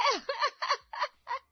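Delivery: high burst of mocking laughter; loud teasing laugh. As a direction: laugh at her outfit. Laughing at bhabhi.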